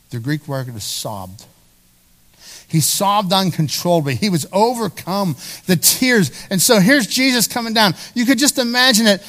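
normal talking voice

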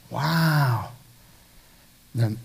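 soft, drawn-out wow; hushed awe. Real, not acted. Preacher reacting to Jesus' promise of life, hushed awe. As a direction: let the wonder sink in quietly.

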